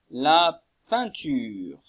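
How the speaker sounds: La peinture drawn out slowly, every sound clear; teacher models La peinture very slowly before handing over; slow model word. Shown as a demonstration. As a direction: say La peinture slowly so pupils can copy it. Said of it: "À vous".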